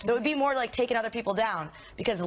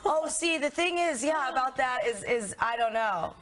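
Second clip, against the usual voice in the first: high-pitched, stalling politician act; imitating Hillary; 4 semitones higher than usual. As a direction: stammer round a non-answer, starting high and sinking. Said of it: "pretty much all she ever says".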